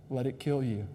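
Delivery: normal talking voice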